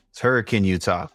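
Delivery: ordinary talk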